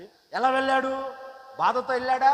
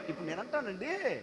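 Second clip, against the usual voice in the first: ordinary talk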